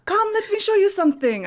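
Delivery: high, loud, welcoming call; eager invitation. Acted. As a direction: beckon her in with loud enthusiasm. Character beckons Greta in play.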